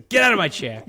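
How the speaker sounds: loud, high gruff-dad order; imitating movie dad. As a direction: bark it like the film's dad. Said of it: "everything he said cracked me up".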